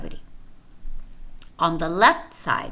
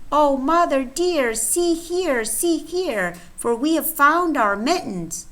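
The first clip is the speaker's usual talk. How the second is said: kittens; 6 semitones higher than usual. Acted. Kittens voiced in rhyme.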